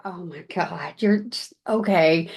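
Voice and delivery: low, indulgent imitation of an idol; amused disbelief. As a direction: humour a gushing fan, voice dropped. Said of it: "with this amusement of".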